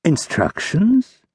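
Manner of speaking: slow, rising feigned surprise; drawn-out pretended astonishment; mock surprise. A narrator's reading. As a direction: ambassador feigning surprise at the question. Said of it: "The ambassador pretended surprise".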